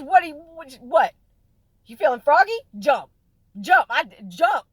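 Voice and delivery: high, loud teasing taunt; mock shouted dare. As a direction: goad him to jump. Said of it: "He says".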